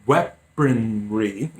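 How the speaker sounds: "weaponry" stretched out syllable by syllable; slow, careful. Real, not acted. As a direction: say "weaponry" very slowly, sounding it out. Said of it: "I have to say it slowly".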